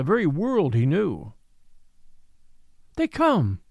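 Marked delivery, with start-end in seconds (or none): 3.0-3.6 high, bright, joyful call; gleeful cry. Acted. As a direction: call out gaily. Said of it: "she cried gaily".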